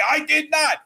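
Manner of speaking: high-pitched indignant denial; mock the other driver yelling his denial; retold yelling back. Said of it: "now he's yelling back at me".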